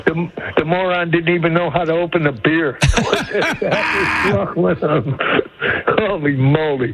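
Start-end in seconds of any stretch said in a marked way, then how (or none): 2.8-5.9 a long, wheezing belly laugh; hearty laugh. Real, not acted. Caller cracking up over the beer video, wheezing laugh. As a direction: let the laugh roll out till you're nearly crying.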